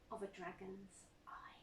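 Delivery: breathy whisper to close the poem. Acted. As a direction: let the last words fall to a whisper. Poem ending on a hush.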